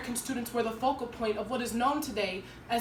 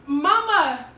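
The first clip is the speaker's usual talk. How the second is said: louder, raised-pitch plea to mother; reassuring a worried mother; 6 semitones higher, 8 dB louder, slower than usual. Acted. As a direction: call out to calm your mama. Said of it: "so I told her".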